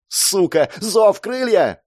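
high, drawn-out shouted summons; swear, then cry out the incantation; desperate spell cry. Acted. Girl summons wings in desperation, shouting.

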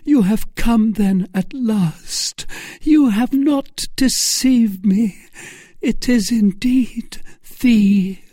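high, slow, overjoyed heroine; high-pitched rapturous lady's voice; joyful young woman. Acted. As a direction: cry out high to a long-awaited love.

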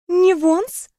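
высоко, с подъёмом на «Не Вонс?»; переспросить, не веря; изумлённый переспрос. Acted. (переспрашивает в изумлении)

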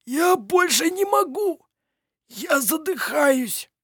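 hoarse. A narrator's reading. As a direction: gasp the words out hoarsely. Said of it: "хрипло выдавил из себя Петер". (хрипло, задыхаясь)

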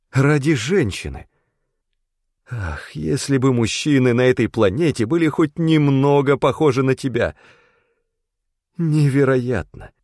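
put-on a moved young woman protesting; tearful woman's voice. A narrator's reading. Tazi's emotional outburst, voiced.

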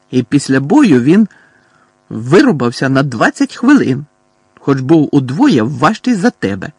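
loud, emphatic bragging; loud boast. A narrator's reading. Boy defending his boxing win to cousin, loud boast. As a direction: keep shouting the boast, hitting key words.

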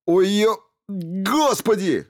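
loud, high cry of pain; screaming in pain. A narrator's reading. Stevens cries out during the surgery, high pained scream. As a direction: scream as the needle goes in. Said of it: "заорал Стивенс".

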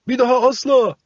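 high, loud eager promise; chorus promise. A narrator's reading. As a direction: promise it together, loudly. Puppets promise together.

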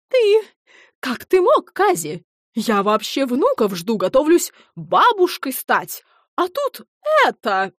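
high, loud upset accusation; tearful outrage. Acted. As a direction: scold him, near tears. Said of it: "неожиданно всхлипнула". (возмущённо, со слезами)

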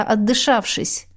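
ordinary delivery, nothing marked

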